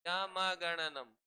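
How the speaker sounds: यमगणनम drawn out very slowly, syllable by syllable; slow recital. Shown as a demonstration. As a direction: stretch यमगणनम slowly for students to repeat. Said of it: "बोलो मेरे साथ".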